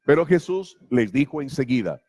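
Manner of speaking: normal talking voice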